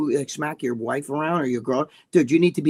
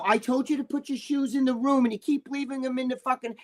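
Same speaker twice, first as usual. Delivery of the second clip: imitating a petty squabble, pitched high; high-pitched pretend bickering over nothing; mock nagging; 7 semitones higher, 4 dB quieter than usual. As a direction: scold in a mock high voice, without shouting. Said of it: "sit in an old fight over stupid shit".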